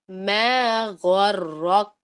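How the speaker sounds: غرق drawn out very slowly with breathy release; hams demo. Shown as a demonstration. Teacher shows students hams on غرق, slow and breathy. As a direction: stretch غرق and let the air out at the end. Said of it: "دیکھیں".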